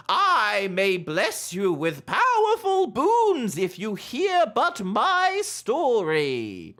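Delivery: booming, high theatrical toad king; grand showman welcoming mortals; horror host. Acted. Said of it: "you hear both physically with your ears and in your heads".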